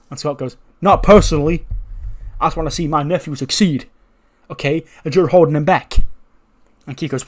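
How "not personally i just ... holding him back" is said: loud retort. Acted. Scott retorts to Keith.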